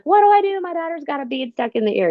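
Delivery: high-pitched, breathless panic at a neighbour's door; frantic panic. Retold or imitated. Mother at the neighbour's door, panicking over her daughter's ear. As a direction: blurt it out like the world is ending. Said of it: "as if it was the end of the world".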